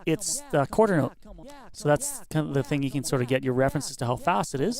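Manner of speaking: ordinary talk, nothing marked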